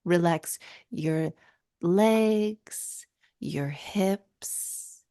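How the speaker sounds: slow, soft meditation voice with a whispered end; guided meditation. Shown as a demonstration. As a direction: guide the listener to relax, slowly. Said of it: "demonstrating her guided meditations".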